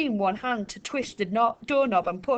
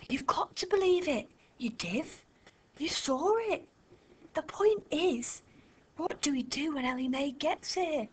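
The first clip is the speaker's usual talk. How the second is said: hushed urgent whisper; 6 semitones higher, 6 dB quieter than usual. A narrator's reading. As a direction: whisper it urgently, close to the ear. Children whispering at night.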